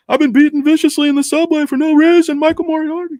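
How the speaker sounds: bad actor impression, very high pitch; hammed-up TV drama voice, high and loud; Michael Moriarty. Streamer badly imitates a TV prosecutor, high hammed-up voice. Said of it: "can't really do a Michael Moriarty impression".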